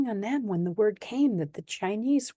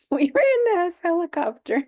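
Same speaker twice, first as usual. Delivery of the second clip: laughing, higher and louder; 11 semitones higher, 8 dB louder than usual. Real, not acted. Starting a funny story about the helicopter, laughing. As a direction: laugh through the start of the memory.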